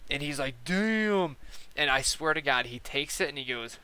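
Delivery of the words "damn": high, stretched mock amazement; impressed exclamation. Newbie watching a huge hit blown, impressed. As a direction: imitate a wowed guy, drawing the word out. Retelling vaper wowed by cloud.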